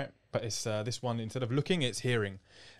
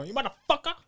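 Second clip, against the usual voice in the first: much higher, laughing movie-style insult; Rush Hour impression; 11 semitones higher than usual. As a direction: squeal it like a Rush Hour character. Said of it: "he should be in Rush Hour".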